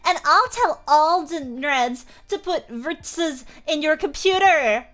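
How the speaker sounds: high mock-angry author's rant; hammed-up angry rant. Acted angry author's note rant.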